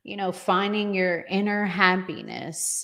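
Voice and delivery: ordinary delivery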